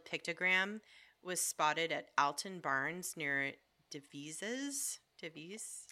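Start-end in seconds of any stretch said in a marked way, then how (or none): 3.9-5.0 Devizes said "de-VEE-ziz", questioning; trying a pronunciation. Shown as a demonstration. Host stumbles on a town name, Devizes as "de-VEE-ziz". 5.2-5.9 Devizes said "de-VEES", soft and questioning; trying a pronunciation. Shown as a demonstration. Host tries the town again, Devizes as "de-VEES". Trying out an unfamiliar place name.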